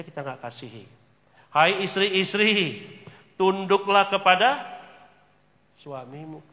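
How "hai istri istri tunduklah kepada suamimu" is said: loud, slow proclaiming of the verse; preaching verse. Acted. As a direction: call it out over the congregation.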